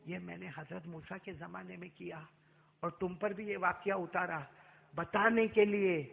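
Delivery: soft, tender words to the beloved; soft and loving, as if to a dear friend; loving tenderness. Acted. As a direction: say it softly, full of love. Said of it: "اللہ نے اتنے پیار سے کہا".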